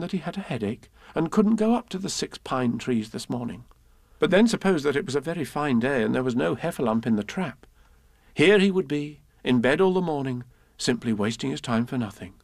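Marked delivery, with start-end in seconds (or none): none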